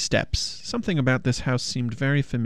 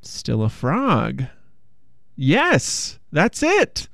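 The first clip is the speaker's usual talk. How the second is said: prince's sudden idea; 2 semitones higher, much slower than usual. A narrator's reading. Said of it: "The prince thought".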